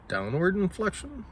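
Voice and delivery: "downward inflection" rising high at the end like a question; upward inflection. Shown as a demonstration. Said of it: "that's an upward inflection".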